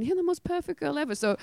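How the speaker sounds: ordinary delivery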